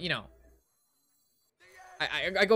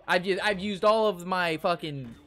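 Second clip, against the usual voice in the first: ordinary talk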